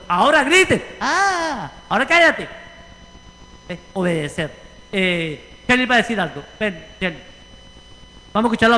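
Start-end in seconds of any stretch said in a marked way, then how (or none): 0.1-2.5 mock commander.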